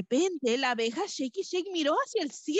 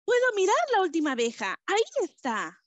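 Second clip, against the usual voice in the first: called out; 7 semitones higher, much faster than usual. Acted. The bee shouts to Marvin and Fabi, high and fast. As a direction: call out quickly in a high bee voice. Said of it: "le gritó".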